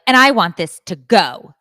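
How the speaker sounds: loud, emphatic demand; mimicked demanding customer. Daughter mimicking customer changing order, loud demand. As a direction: announce it loudly as a curt add-on.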